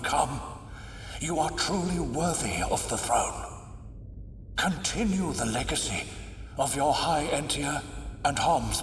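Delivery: a weakened father's soft blessing; soft and fading. Acted. Emperor blessing his daughter Melia before the throne, soft and fading. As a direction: speak gently, barely above a breath.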